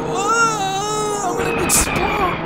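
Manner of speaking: high-pitched frantic yelp; panicking gamer. Real, not acted. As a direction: shriek in gaming panic. Can't hit the droid.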